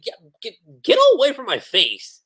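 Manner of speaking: acted flustered push-away, tripping over the first word. Urza to the man praising her, flustered. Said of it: "just how flustered Urza gets".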